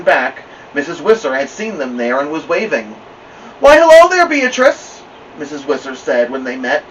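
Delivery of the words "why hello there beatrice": cheery neighbor greeting. A narrator's reading.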